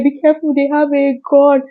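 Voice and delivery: high, urgent warning call. Retold or imitated. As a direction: shout it to people coming outside. Warning neighbours about the robbers.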